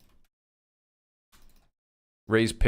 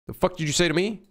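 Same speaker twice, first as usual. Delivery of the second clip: mock outrage; 8 semitones higher, faster than usual. Retorting to a robotic error voice.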